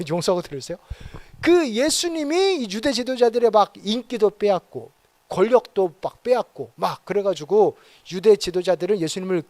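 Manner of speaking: ordinary delivery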